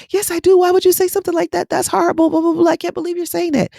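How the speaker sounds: high, fast, indignant whine; a hammed-up high indignant squeal; mock outraged client. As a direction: mimic a defensive client's protest. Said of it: "And he was so angry with me".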